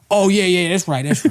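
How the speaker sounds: high-pitched mock yes-man; agreeing writer. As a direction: agree eagerly as the boss's writer. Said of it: "He's just like".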